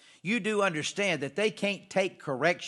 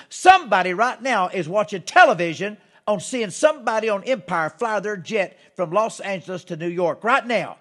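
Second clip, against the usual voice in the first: louder, driving preacher push; fired-up preaching; 3 semitones higher, 7 dB louder than usual. Real, not acted. Preacher to TV viewers, fired-up louder preaching. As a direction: lean in and raise the crowd.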